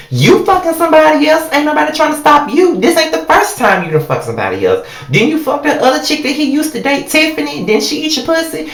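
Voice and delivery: high mocking incredulous jab. Taunting Erica about her past flings, high and mocking. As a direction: throw her own hypocrisy back in her face.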